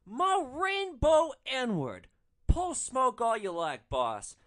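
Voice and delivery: high, loud, slow put-on voice of the passenger; hammed-up mock thug praise; imitating the passenger. Passenger hyping up his driver, in a high loud drawn-out voice.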